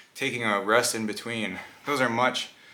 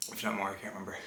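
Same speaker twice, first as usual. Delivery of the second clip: whispering; 3 semitones lower, 10 dB quieter, faster than usual. Real, not acted. Whispered aside about prize.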